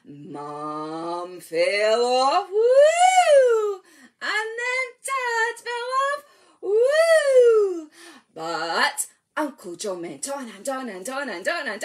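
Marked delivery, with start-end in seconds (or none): 2.5-3.8 high loud whoop; excited woo. Acted. Leader cheering the tumble for babies, high whoop. Mum falls off in rhyme. 6.6-7.9 high loud whoop; excited woo. Acted. Leader cheering the tumble for babies, high whoop. Dad falls off in rhyme.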